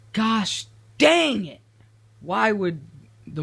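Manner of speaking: loud, high, slow-punched curse; exasperated outburst. Real, not acted. Builder finding an update broke his machine, loud exasperation. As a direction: burst out in frustration, drawing it out.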